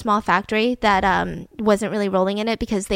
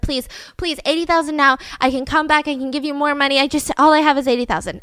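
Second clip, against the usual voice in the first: higher-pitched mock begging; pleading; 4 semitones higher than usual. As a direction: plead urgently as the retold hostage. Hostage begs gang to accept.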